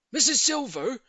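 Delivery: high, loud call of her name; eager address. A narrator's reading. Neighbour seizing his chance, loud eager call. Mr Hoppy calls.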